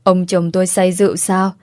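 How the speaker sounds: plain reading voice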